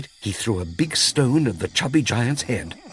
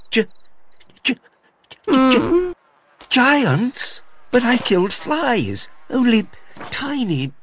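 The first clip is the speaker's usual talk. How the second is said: frightened little tailor stuttering, pitched way up; frightened stammer; slower than usual. Acted. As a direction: stammer it out, scared stiff.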